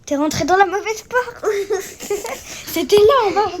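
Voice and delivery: high voice broken by laughter; laughing. Real, not acted. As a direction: laugh through the words. Laughing at friend's mistake.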